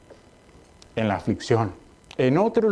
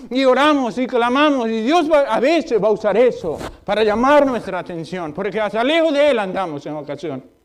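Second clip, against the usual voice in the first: high, loud preacher's cry; fiery pulpit voice; full-force preaching; 10 semitones higher, 5 dB louder than usual. Acted. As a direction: preach it hard to the congregation.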